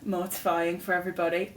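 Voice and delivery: normal talking voice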